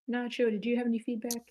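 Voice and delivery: ordinary talk, nothing marked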